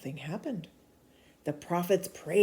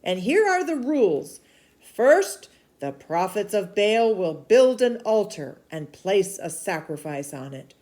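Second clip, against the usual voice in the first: urgent prophet; 3 semitones higher, 8 dB louder than usual. Acted. Elijah calling the people to decide, urgent and loud. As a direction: press the crowd to choose.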